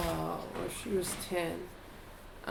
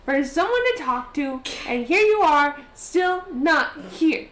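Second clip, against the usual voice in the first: put-on higher, louder pleading little girl; lonely girl's letter voice; 8 semitones higher, 7 dB louder, slower than usual. Letter voice of a lonely girl missing her friend, pleading. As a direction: read it as a lonely ten-year-old calling out. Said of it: "it probably says something along the lines of".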